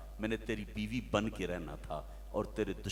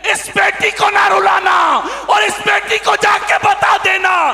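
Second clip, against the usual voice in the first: high, very loud, slow sung-out preacher's cry; fiery preaching; 23 dB louder than usual. Acted. Preacher crying out to the young man about his daughter, full force. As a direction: preach it at the top of your voice.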